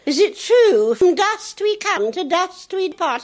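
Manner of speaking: put-on high, slow little girl voice; high-pitched little girl asking her mummy, slow. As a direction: ask solemnly like a small child.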